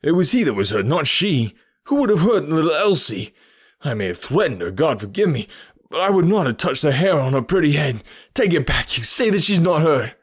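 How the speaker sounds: loud, high, impassioned denial; fierce cry. A narrator's reading. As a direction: argue at full voice, desperate to be contradicted. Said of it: "he cried fiercely".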